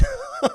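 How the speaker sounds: short high giggle; brief amused burst; real laugh. Real, not acted. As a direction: chuckle between phrases. Laughs at own doubt.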